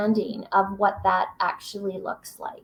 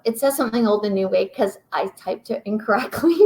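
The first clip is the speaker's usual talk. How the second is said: ordinary delivery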